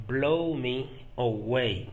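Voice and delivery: "Blow me away" drawn out in separate parts; slow it down, pausing after "Blow me"; careful, slow teaching pace for "Blow me away"; slow repetition. Shown as a demonstration. Teacher repeats phrase slowly for learners.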